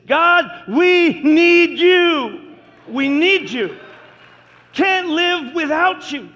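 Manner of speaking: loud pleading cry to God. Retold or imitated. Pastor voicing the congregation's cry to God, loud pleading. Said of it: "It's been the cry of my heart".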